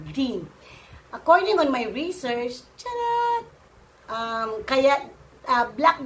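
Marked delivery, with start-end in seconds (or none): 2.8-3.4 mock squeal. Joking disclaimer.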